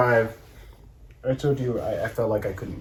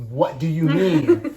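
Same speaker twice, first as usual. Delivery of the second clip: drawn-out, raised confrontational question; louder slow demand; 5 semitones higher, 11 dB louder, slower than usual. Retold or imitated. In the scene, demanding answers from his partner, slow and loud. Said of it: "I was like".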